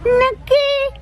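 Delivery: put-on high-pitched baby-talk demand; whining toddler. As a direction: squeal it like a tiny child who can barely talk. Crying toddler demands a snack.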